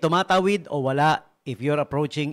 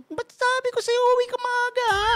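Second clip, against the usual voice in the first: very high, loud scolding wife voice; shrill squeal of a wife scolding a late husband; mock-scolding wife in a piercing high pitch; ultrasonic nagging wife; 3 dB louder than usual. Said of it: "yung pag nakakarinig ako ng gano'n".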